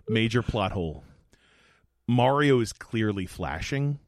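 ordinary delivery

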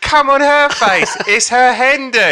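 high, loud, drawn-out yell; insistent heckler. Retold or imitated. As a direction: shout the demand at the stage, stretching it out. Heckler repeats demand, more forceful.

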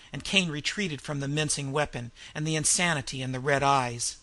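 usual speaking voice

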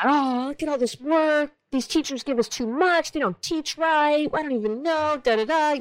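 mock teen griping about homework; freaking-out teenage son. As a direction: lightly imitate a stressed kid complaining. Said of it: "my boy, let's say, is freaking out".

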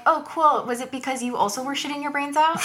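faux-bright high sarcasm; mock cheerful. Answering a weight compliment with faux-bright sarcasm. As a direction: sweetly deliver the gross punchline.

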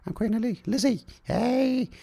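high, posh-put-on old queen; a falsetto queen impression, lightly hammed; mock Queen voice. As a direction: play the Queen, high and prim. Podcaster voicing the Queen.

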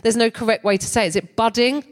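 normal talking voice

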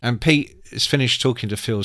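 usual speaking voice

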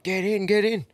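high-pitched, urging get-in call; urgent mock command. Driver hurrying the lads into his car, urgent.

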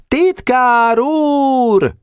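very high, long-drawn yell; howling after him. A narrator's reading. Weiss howling after the fleeing secretary, high and drawn out. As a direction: stretch the call out after a runner. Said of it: "süvöltött utána Weiss".

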